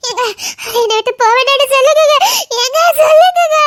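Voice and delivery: desperate wailing plea; crying shout. Acted. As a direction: shout the plea through sobs. Pleading husband to stop her.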